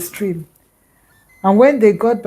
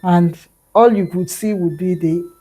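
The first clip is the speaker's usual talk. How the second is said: ordinary delivery, nothing marked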